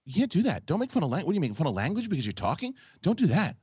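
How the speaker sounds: high, fast, fretful whine of outrage; an overwrought worrier piling on objections; personified anxiety. Pretend. Mocking a cohost for voice impressions, as anxious whiner. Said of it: "That's what lives in my head".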